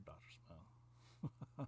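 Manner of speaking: drawn-out whisper of the name. Real, not acted. Storyteller whispers the name as an inside joke. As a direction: breathe "Doctor Smith" slowly as a secret. Whispered aside about a reference.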